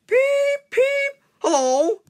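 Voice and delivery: very high piping peeps; whistle peeps. Acted. Engine whistling hello to the boys, piping. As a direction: squeak like a tiny whistle. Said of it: "they cried".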